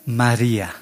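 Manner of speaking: low, soft, sweet calling of a name; tender, peaceful call. Acted. Jesus calls María in her confusion, tender and peaceful. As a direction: say the name gently, softly and low. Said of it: "sabe hablar con paz, dulzura".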